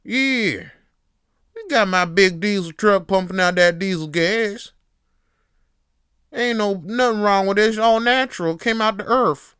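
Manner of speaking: high, blaring Southern buffoon voice; Southern hillbilly accent. Acted. Hillbilly bragging about his diesel truck, shouted Southern accent. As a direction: holler proudly like a cartoon Southern hillbilly. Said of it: "Southern hillbilly being a buffoon".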